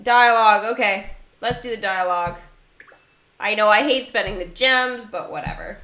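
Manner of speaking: usual speaking voice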